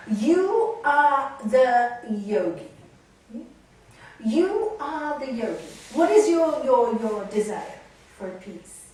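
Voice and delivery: loud, slow, weighty pronouncement; guru telling-off. Retold or imitated. Guru reminds Nalini that she is the yogi, stern and loud. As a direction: imitate a guru, hammering each word. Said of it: "the one who's told off".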